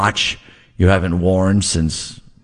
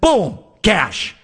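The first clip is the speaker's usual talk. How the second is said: excited burst; 9 semitones higher than usual. Acted. Ad read punchline, jokey accent.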